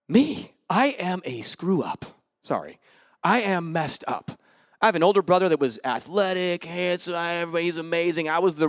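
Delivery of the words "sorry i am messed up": ordinary talk, nothing marked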